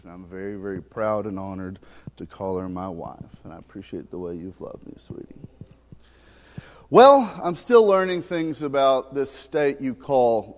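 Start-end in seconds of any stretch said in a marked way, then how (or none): 0.0-5.4 tender to wife. Real, not acted. Tender words to his wife.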